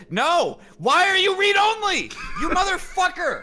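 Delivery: high, loud exasperated shouting; real rage. Real, not acted. Player cursing a spreadsheet that went read-only, enraged yelling. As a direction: yell furiously at a misbehaving program. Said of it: "The rage is completely reasonable".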